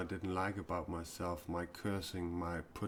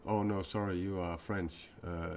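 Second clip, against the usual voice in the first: usual speaking voice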